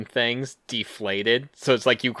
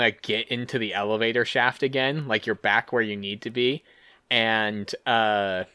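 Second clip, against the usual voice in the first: ordinary talk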